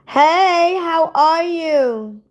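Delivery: high, slow sugary hello; sweet greeting. Acted. Greeting a viewer on the live, high and sugary. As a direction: coo it at her like a sweetheart.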